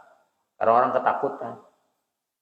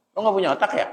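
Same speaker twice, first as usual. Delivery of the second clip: provoking taunt, pitched higher; picking a fight; 9 semitones higher, much faster than usual. Shown as a demonstration. Preacher mimics someone picking a fight, provoking. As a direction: throw it out like you're starting a fight. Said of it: "Ajakin berantem".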